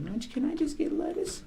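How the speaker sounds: high, laughing timid woman; lightly mocked nervous lady; timid customer. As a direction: squeak it like a scared customer. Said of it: "She said".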